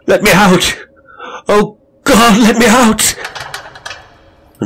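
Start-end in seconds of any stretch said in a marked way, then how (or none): 0.1-3.2 high, loud anguished scream; tormented agony. Acted. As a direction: cry out in torment at the door. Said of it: "in a voice of tormented agony".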